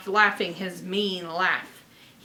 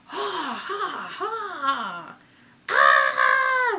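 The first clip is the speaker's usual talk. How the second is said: ghost laugh and scream; 5 semitones higher, 4 dB louder, much slower than usual. Acted. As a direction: cackle like a ghost, then roar.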